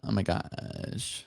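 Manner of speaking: low, slow, soft vocal fry; Kardashian vocal rasp. Retold or imitated. Showing how the Kardashians talk, lazy creaky rasp. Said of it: "you know how the Kardashians talk".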